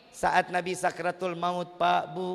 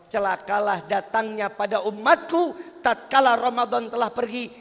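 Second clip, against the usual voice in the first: raised warning; 5 semitones higher, 7 dB louder than usual. Acted. Prophet's warning, loud quote.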